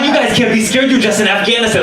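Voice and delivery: high-pitched disbelief; panicked protest. Retold or imitated. Jumper protesting to the frightened soldiers, high and panicked. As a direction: argue in mock alarm.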